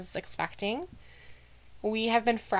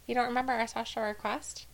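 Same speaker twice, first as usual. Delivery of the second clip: normal talking voice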